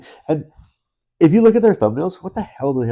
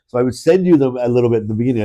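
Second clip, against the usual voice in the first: ordinary talk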